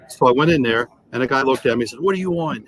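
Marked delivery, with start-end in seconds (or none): none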